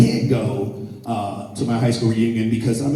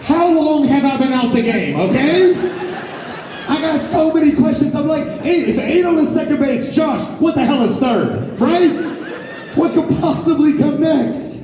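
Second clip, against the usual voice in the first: incredulous shout; 12 semitones higher than usual. Retold or imitated. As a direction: yell it like the rules changed without you. Retold shocked reply to date.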